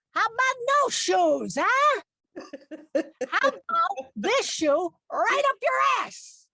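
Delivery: high, loud, slow blow-up; angry Italian mom. Retold or imitated. Italian mother losing it at her picky child, slow furious yell. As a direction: snap from fake sweetness into a fury. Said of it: "that was just my mom".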